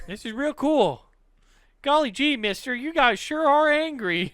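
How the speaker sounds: put-on high, wide-eyed golly-gee kid; naive kid voice. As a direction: play it innocent and chirpy.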